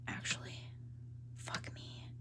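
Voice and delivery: a whispered swear to herself; whispered curse. Real, not acted. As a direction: breathe the curse out under breath. Muttered aside to herself.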